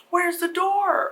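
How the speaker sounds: high, drawn-out panicked wail; freaking out. Acted. Trapped girls searching for the way out, freaking out. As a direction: stretch it out in high-pitched alarm. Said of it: "the girls are freaking out".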